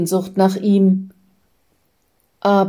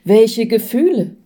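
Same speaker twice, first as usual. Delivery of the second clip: mildly baffled question; astonished; 3 semitones higher than usual. A narrator's reading. Man asking his guide which feelings, mildly astonished. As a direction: ask in surprise, lifting the voice then letting it fall. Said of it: "fragt der Mensch erstaunt".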